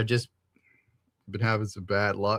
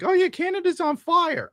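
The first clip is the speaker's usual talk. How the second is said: mock casual voice. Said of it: "somebody's like".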